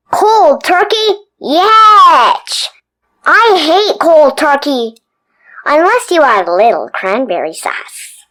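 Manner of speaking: grossed-out tooth; disgusted tooth. Acted. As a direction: recoil in disgust.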